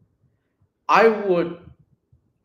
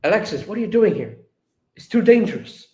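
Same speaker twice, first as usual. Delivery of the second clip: higher, urgent worried warning; worried and insistent, pitched up; 7 semitones higher, 4 dB louder, faster than usual. Acted. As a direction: act alarmed for her safety. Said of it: "he's worried".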